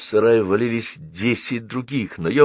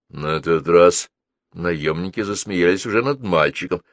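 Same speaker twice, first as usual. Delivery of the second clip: ordinary delivery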